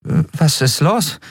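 high, slow puzzled question; confused. A narrator's reading. Endriel asks Kero about the sudden landing, confused. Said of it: "fragte Endriel verwirrt".

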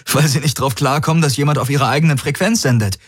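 fast, high, breakneck realisation; amazed. Acted. Galen explains why they stopped firing, amazed. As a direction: blurt it out in amazement. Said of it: "Garland keuchte verblüfft".

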